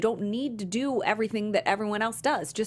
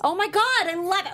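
much higher and louder, thrilled; delighted squeal; 12 semitones higher, 5 dB louder than usual. Real, not acted. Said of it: "It's like a blind dress".

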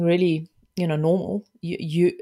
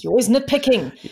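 higher, louder exasperated complaint; triggered outburst; 6 semitones higher, 4 dB louder than usual. Acted. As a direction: act out a partner taking offence. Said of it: "But you're being triggered".

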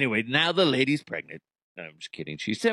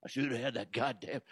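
ordinary talk

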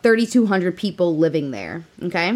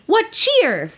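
miner's exclamation; 12 semitones higher than usual. Retold or imitated. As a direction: shout it out like a miner striking coal.

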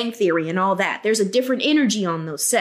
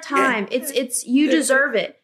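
normal talking voice

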